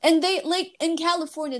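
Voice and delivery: ordinary talk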